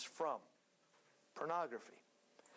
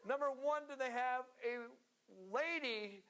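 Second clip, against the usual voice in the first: laughing; 6 semitones higher than usual. Real, not acted. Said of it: "I'm trying to figure this out".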